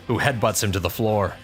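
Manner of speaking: usual speaking voice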